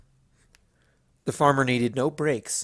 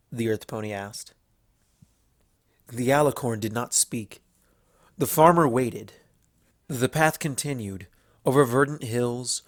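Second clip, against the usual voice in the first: plain reading voice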